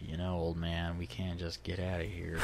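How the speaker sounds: low, hushed aside; quietly confiding to an old man. Retold or imitated. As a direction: say it softly and low, under the breath. Quoting himself addressing his dad.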